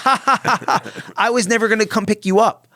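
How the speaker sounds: high, loud exasperated rant by wife; wife's exasperated rant. Retold or imitated. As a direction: vent years of frustration, mimicking her. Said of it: "there was this, laughs".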